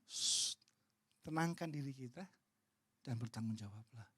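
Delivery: calming. Retold or imitated. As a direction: soothe someone who is upset. Menenangkan orang yang protes. (menenangkan dengan suara lembut)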